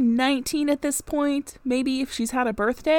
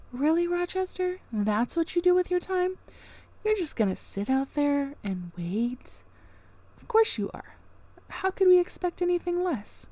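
much higher, mocking disbelief; sarcastic aside; 6 semitones higher, 4 dB quieter than usual. Real, not acted. Reader mocks Rochester for waiting at the door, sarcastic and high.

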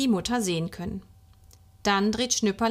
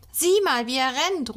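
excited high call, loudest at the start; child's eager exclamation; 5 semitones higher, 4 dB louder than usual. A narrator's reading. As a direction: cry out to point something out. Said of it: "ruft Schnüpperle".

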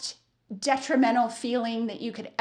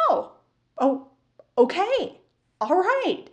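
surprised relief; 6 semitones higher than usual. Retold or imitated. Relieved reply to customer.

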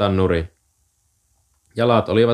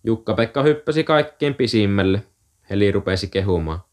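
ordinary delivery

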